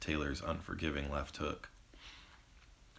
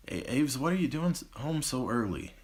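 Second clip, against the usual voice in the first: higher, stammering surprise; surprised call; 8 semitones higher than usual. Acted. Mother to daughter home early, startled and stammering. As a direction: caught off guard, stumbling over the name.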